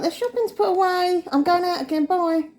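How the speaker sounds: lightly mocking high-pitched mum; mum on phone. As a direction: mimic mum breezily signing off a call. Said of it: "she rang me and she's like".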